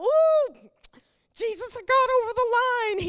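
high, loud startled squeal; a hammed-up gasp of dismay; mock alarm. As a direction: play a believer who realises he overstepped. Acted believer's alarm.

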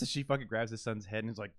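ordinary talk, nothing marked

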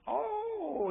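high, long, falling "Oh"; drawn-out recognition. Acted. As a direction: stretch out a high oh of mock realisation. God recognising a man, acted.

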